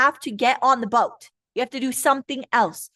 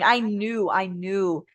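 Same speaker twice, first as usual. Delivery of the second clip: normal talking voice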